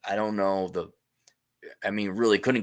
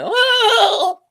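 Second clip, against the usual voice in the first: mock shocked oh; 9 dB louder than usual.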